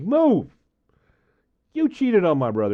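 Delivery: high, loud angry yell at the ex; retold furious yell at a cheating ex; angry shout. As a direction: shove her off with your voice. Confronting the ex, shouted.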